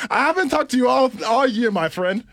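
put-on loud, high, fast Nigerian accent; Nigerian dad voice. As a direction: beam it out like a jolly Nigerian father. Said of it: "that's how Nigerians, like, talk".

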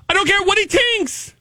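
high-pitched mock Scottish; Scottish accent. Shown as a demonstration. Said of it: "That Scottish came in second".

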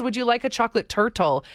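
mockingly careful "tur-tull"; over-enunciated turtle. Shown as a demonstration. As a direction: pronounce "turtle" with a pedantic crisp second T. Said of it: "Nobody says".

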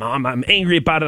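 ordinary talk, nothing marked

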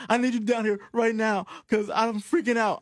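high mock panic on the phone; imitating a frantic caller; panicked wife. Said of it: "she was calling her husband".

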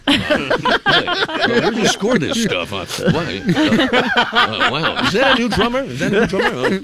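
a jittery high host; Johnny Carson on cocaine. Pretend. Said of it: "It's Johnny Carson on cocaine".

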